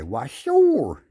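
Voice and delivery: high, loud put-on fish voice; goofy talking fish. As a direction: answer like a cheerful fish. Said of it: "that fish gonna say".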